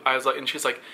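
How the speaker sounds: ordinary delivery, nothing marked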